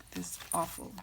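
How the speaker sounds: speaking through a scratchy throat; itchy throat. Real, not acted. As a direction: talk with a tickle in the throat. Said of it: "awful little itch in my throat".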